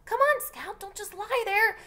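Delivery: high, loud, frantic yell; panicked big brother yelling; screaming. Acted. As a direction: scream at her to get up. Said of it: "Jem was screaming".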